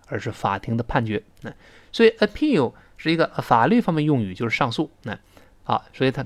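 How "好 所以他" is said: ordinary talk, nothing marked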